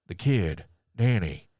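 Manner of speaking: flat, unbothered mock reply; dry, unconcerned. Mocking the woman's dry answer. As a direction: toss it off like nothing matters. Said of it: "the most dry, non-concerned voice".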